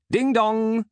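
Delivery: high, loud spoken chime; robot butler's doorbell. A narrator's reading. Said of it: "said Canterbury over the intercom".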